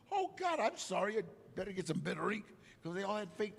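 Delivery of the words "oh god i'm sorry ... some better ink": a lightly voiced high, fast mock apology. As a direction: rattle off a joking sorry. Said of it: "I just kept sm".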